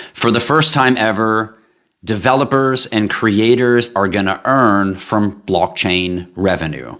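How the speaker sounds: ordinary talk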